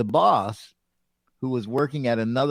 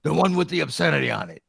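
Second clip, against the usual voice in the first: quick, slightly raised boss voice; boss curt; 3 semitones higher, faster than usual. Retold or imitated. Boss at the door, quick mild curt voice. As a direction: rattle it off like an annoyed boss. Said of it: "What shirt?".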